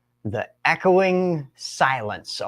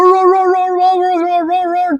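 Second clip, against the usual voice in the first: much higher, louder barking noise; imitated dog barking; 6 dB louder than usual. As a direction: bark like frantic dogs. Dogs running around just roar.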